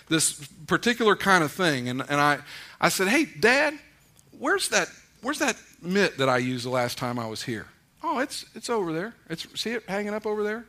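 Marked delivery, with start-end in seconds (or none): 3.1-10.6 a touch of easy, casual call to dad; light everyday back-and-forth; casual intimate. Retold or imitated. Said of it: "That's how we talk".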